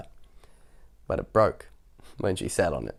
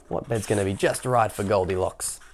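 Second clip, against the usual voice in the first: ordinary delivery, nothing marked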